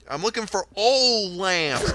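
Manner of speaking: slow, high Southern drawl on "oil"; Southern accent. Retold or imitated. Customer repeats himself to the confused worker, drawn-out Southern accent. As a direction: stretch oil into a long Southern "all". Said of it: "you don't speak properly".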